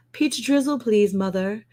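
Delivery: high prim plea for peach drizzle; posh kid request. Pretend. Kid politely asking mom for peach drizzle, prim posh voice. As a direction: ask sweetly like a fancy child. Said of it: "they have to say mother".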